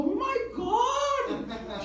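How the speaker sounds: very high, drawn-out, loud squeal; mom's ecstatic shriek. Retold or imitated. Mom hearing son met Russell Peters, ecstatic shriek. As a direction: imitate an overjoyed mother, every word stretched high. Mom's reaction to celebrity photo.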